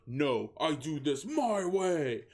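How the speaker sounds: mock-heroic slow angry declaration; furious. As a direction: growl it out like a cartoon hero. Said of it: "said Garfield with fury".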